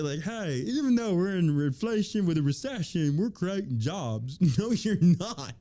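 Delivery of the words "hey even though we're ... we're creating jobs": put-on mocking official voice; mock spokesman. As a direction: parody an upbeat official announcement. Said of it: "they can sit there and say".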